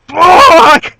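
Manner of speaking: sudden high, loud outburst of frustration; frustrated shout. Retold or imitated. Reviewer re-enacting his yell at the TV, a high furious shout. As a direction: yell it like your show just let you down. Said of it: "I was so fucking pissed".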